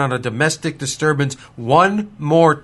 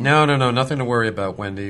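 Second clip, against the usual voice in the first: reassuring; 4 semitones lower, 4 dB quieter, faster than usual. Acted. Sheriff reassuring Wendy.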